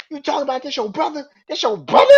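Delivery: high, fast, loud taunting; angry Jay. Retold or imitated. Jay mocking Sami over his brother, high taunting yell. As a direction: sneer it as a challenge. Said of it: "Jay jumps up".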